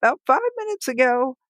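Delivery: high-pitched voice of the son; imitated son. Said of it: "and he said".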